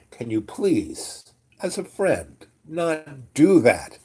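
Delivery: slow, broken-up plea, "not do that" leaned on hard; pointed plea. Retold or imitated. Asking the master as a friend, pointed mock plea. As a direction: spell out "not do that" slowly and emphatically.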